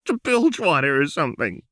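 high, loud, desperate cry. A narrator's reading. Jinx begging Lux to run away, high tearful cry. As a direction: plead through tears. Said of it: "Jinx cried".

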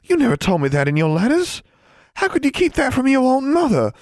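high, loud, distraught outburst; appalled. A narrator's reading. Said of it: "Julia's appalled face".

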